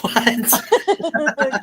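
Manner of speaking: high, loud laughing disbelief; shocked reaction. Retold or imitated. Retelling reaction to hidden bodies rumor, laughing shock. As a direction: laugh out a shrieked question. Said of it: "I was like".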